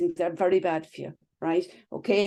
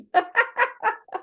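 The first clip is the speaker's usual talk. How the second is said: sudden high-pitched laugh; real amused laugh, high; high burst of laughter. Real, not acted. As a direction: let a squeal of laughter out. Laughs at own joke.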